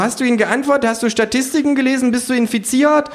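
high, urgent interrogation; shrill, pressing kangaroo voice. A narrator's reading. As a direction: fire anxious checks in a high animal voice.

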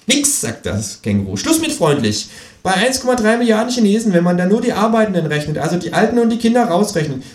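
high, pert kangaroo voice lecturing; sassy kangaroo holding forth; cheeky kangaroo. Acted. Kangaroo mocking the economist on live TV, high sassy voice.

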